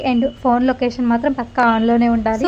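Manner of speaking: usual speaking voice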